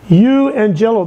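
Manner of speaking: Euangelo said slowly as yoo-an-JEL-oh, stress on JEL; Greek pronunciation. Shown as a demonstration. Preacher teaching the congregation to say Euangelo, yoo-an-JEL-oh. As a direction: spell out Euangelo syllable by syllable. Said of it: "is the word euangelo".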